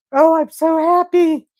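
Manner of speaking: high, loud happy wail; real gush of happiness, pitched high; overjoyed. Real, not acted. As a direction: cry out with overwhelming joy. Overjoyed at friend's weight loss.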